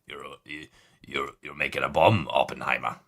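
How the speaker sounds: put-on low, deep West Country Hagrid accent; fading Hagrid accent. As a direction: keep the Hagrid accent for the joke. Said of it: "Nope, that's not right".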